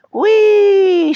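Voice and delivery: very high, loud whoop of delight; gleeful cry. A narrator's reading. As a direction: squeal with joy as she leans into the wind. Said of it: "she cried".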